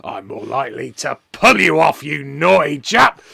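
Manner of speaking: loud, bellowing wrestler taunt; Mister McMania. Retold or imitated. Wrestler taunting the man he nearly pushed off, bellowing. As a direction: bellow it at your victim, gloating.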